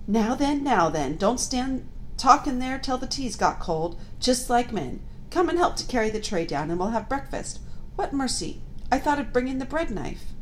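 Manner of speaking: high bustling motherly voice; fussy housewife voice. A narrator's reading. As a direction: scold them like a fussy mother. Mrs Beaver speaking.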